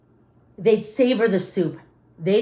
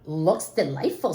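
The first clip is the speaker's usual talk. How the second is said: dad voice; 5 semitones lower, 5 dB quieter, slower than usual. Acted. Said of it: "said the dad".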